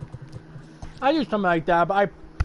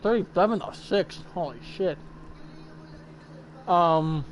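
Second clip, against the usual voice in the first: chewing while talking; 3 semitones lower, 6 dB quieter than usual. Real, not acted. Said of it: "sorry, I'm chewing something".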